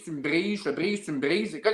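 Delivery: ordinary talk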